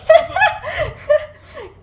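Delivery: high squealing laugh; shrill giggle; burst of laughter. Real, not acted. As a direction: cackle in delight. Laughing at police scene.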